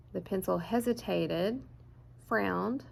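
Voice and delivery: ordinary delivery, nothing marked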